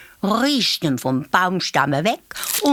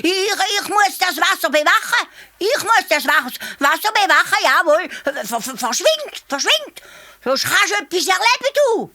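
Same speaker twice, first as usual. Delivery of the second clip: high, shrill blustering hare; bossy hare; 13 semitones higher than usual. A narrator's reading. Hare bullies the calf away from the water, high and bossy. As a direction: puff yourself up and boss him away.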